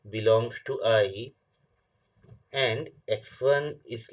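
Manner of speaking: reads the definition slowly, word by word, for note-taking; slow dictation. Shown as a demonstration. Teacher slowly dictating a definition to the class. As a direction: spell it out slowly so students can write.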